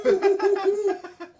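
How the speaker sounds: high loud laugh through the burn; pained laugh. Real, not acted. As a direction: laugh it off while burning.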